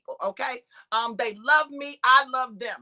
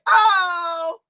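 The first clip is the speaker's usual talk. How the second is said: louder, higher drawn-out oh; acted letdown "oh"; 5 semitones higher, 5 dB louder than usual. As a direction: imitate a long, dismayed oh. Acting out reaction when it ended.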